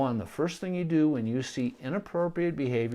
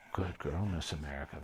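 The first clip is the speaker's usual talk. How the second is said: softly, much lower and softer, praising the dog; very quiet praise; 8 semitones lower, 8 dB quieter than usual. Shown as a demonstration. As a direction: hush your voice to a gentle murmur. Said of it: "very quietly".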